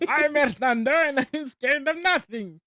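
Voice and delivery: lighter echo of the hero voice; boastful character voice. Pretend. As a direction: repeat the boast playfully. Echoing the quote.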